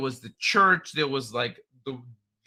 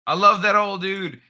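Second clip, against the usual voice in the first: usual speaking voice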